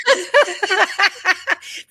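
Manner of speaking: high burst of laughter; bright laugh. Real, not acted. Delighted at the client's reaction.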